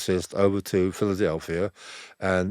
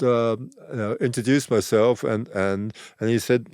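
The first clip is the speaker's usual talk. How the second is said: usual speaking voice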